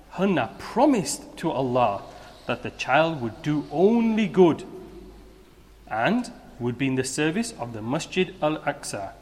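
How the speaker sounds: ordinary delivery, nothing marked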